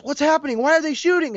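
young Padawan voiced asking why clones shoot, panicked; frightened Padawan. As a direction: ask in confused alarm.

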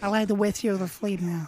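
lisping boxer voice; lightly lisped Tyson voice; Mike Tyson impression. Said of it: "a little bit more".

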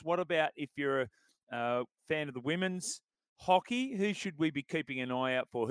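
ordinary talk

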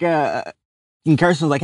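ordinary talk

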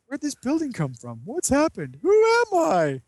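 hammed-up befuddled yelp; mock dazed hero. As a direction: play a brainwashed dimwit. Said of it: "they're in the building".

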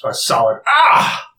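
acted pained shout; cry of pain. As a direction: yelp as the bolt hits. Voices the hit enemy's cry.

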